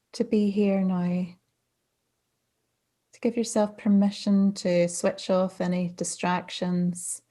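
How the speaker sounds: calm, unhurried meditation guidance with long pauses; guided meditation. Real, not acted. Mindfulness coach settling listeners into practice, calm meditation voice. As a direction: ease listeners gently into stillness, phrase by phrase.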